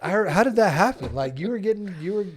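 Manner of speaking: loud, high disbelief; amazed. Real, not acted. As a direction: burst out in disbelief.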